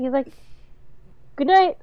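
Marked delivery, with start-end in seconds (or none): 1.3-1.8 high, singly popped mock-polite goodbye. Co-host mimicking Sean leaving, mock polite. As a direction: imitate an obliging caller, pitched well up. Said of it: "at least he's polite".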